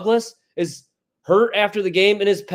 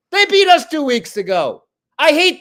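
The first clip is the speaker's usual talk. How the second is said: fired up; 7 semitones higher than usual. Real, not acted. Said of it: "I'm all fired up".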